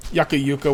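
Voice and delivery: yucca as YUCK-uh, then yucca as YOO-kuh; both pronunciations. Shown as a demonstration. Player covering both camps, yucca as YUCK-uh then YOO-kuh. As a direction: say yucca both ways back to back. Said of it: "I'll just say both".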